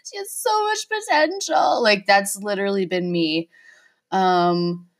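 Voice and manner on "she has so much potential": high, wailing fake sob over a TV show; hammed-up crying, voice pitched way up; mock sobbing. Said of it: "like, sobbing into the bowl".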